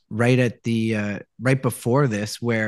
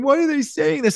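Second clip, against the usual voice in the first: tearful; 13 semitones higher than usual. Retold or imitated. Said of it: "he called me in tears going".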